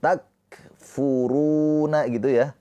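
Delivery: Takfuruna with the ru held for two beats; long ru. Shown as a demonstration. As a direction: stretch the ru of takfuruna. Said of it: "Ru ini dibaca panjang".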